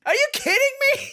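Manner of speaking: very high, fast squeal of disbelief; squeaky, rushed astonishment; incredulous. Real, not acted. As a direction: blurt it out, appalled at the price. Outraged disbelief at the price.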